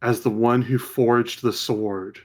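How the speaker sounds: slow, hushed scene setting; measured storyteller voice; game master's vision narration. Acted. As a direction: draw them into the vision.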